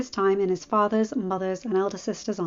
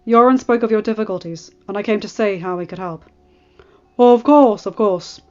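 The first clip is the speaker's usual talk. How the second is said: a hint of a hearty old commander, louder; lightly voiced lord commander; 2 semitones higher, 9 dB louder than usual. The Lord Commander apologizing and welcoming Robb, lightly voiced hearty host. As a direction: welcome them with gruff courtesy.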